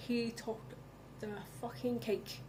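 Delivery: drops to an unvoiced whisper on the cake; hushed whisper. Real, not acted. Ranting viewer whispers the punchline. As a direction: whisper it like a secret. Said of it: "whispered punchline".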